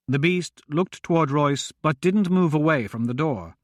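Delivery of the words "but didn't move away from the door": plain reading voice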